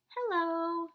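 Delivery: high, bright greeting; high sing-song hello. Acted. Greeting voice in example sentence.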